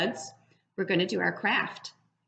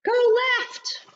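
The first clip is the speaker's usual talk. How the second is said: much higher, louder cheering bug voice; cheering parent bug; 13 semitones higher, 3 dB louder, slower than usual. Acted. Parent bug cheers the baby flying, high and excited. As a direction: cheer the little one through its first flight.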